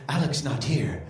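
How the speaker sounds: very deep, slow superhero voice; little boy as Hercules. Retold or imitated. Son playing Hercules answers Dad, very deep and solemn. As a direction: announce yourself as a mighty hero, far down low. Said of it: "pretending he was some superhero".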